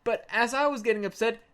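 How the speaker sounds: ordinary talk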